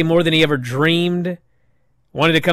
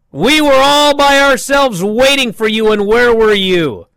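retold angry shout; 8 semitones higher, 5 dB louder than usual. Retold heated wrestling promo.

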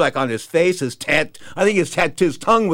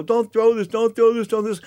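high, pleading repeats; begging; 7 semitones higher than usual. Retold or imitated. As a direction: beg him not to do it. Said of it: "And I begged him".

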